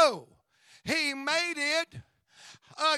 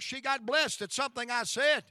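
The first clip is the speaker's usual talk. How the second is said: ordinary talk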